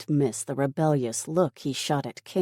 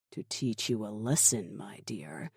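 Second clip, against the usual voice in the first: low, hushed threat; soft and sinister, sinking lower at the end; cold menace; 4 semitones lower, 7 dB quieter than usual. Acted. Killer coldly answers horrified captive.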